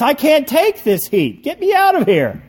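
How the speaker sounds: high, loud cry of protest; bold shout. A narrator's reading. Clay shouting from the hot oven, bold and desperate. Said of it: "I shouted in a bolder voice".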